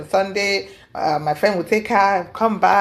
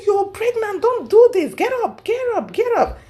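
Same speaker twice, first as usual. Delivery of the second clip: much higher, urgent pleading; 13 semitones higher than usual. Retold or imitated. Telling her pregnant friend on the floor to stand, urgent high plea. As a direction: beg her to get up right now.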